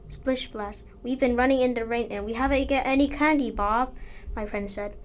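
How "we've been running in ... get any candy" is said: ordinary delivery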